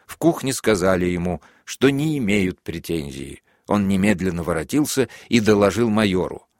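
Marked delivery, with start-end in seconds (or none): none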